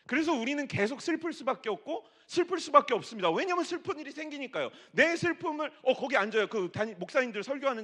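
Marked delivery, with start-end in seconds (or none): 0.1-5.7 loud, high, fast pulpit delivery; preaching. Acted. Pastor preaching to the congregation, loud and high. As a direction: drive the point home to the congregation.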